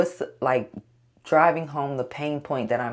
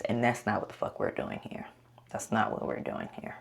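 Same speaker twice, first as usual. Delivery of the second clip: half-whispered aside to herself; muttered whisper; 4 semitones lower, 8 dB quieter than usual. Real, not acted. Vlogger muttering a reminder to herself. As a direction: drop to a hushed mutter.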